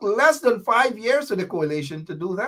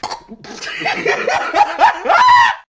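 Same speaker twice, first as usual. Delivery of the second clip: high loud cackling laugh; loud burst of laughter; 7 dB louder than usual. Real, not acted. Vlogger laughing at his jab at a rival, loud cackle. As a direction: let out a big triumphant laugh.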